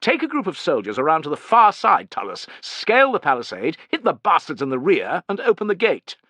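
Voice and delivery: high, loud officer's voice; haughty young commander. A narrator's reading.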